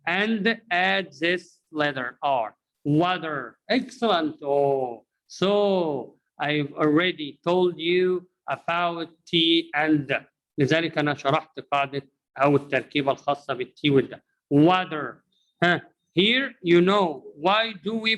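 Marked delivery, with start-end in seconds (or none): 2.8-3.6 "Water" with the T softened to D, "wadder". Shown as a demonstration. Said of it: "T here will be pronounced the letter". 14.5-15.2 "Water" said "wadder", T turned to D; one word "wadder" for "what are". Shown as a demonstration. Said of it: "T here will be pronounced the letter".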